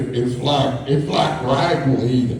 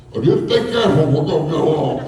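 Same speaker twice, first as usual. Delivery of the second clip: rider woman; 4 semitones higher, faster than usual. Retold or imitated. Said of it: "And she said".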